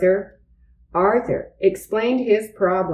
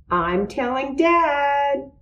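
put-on high childish warning; 7 semitones higher, 3 dB quieter than usual. A narrator's reading. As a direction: threaten to tell Dad, high and light. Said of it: "warned D W".

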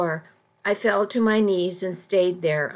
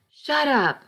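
yelled; 6 semitones higher than usual. A narrator's reading. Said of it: "I, I yelled".

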